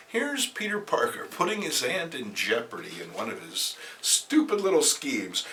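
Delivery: ordinary talk, nothing marked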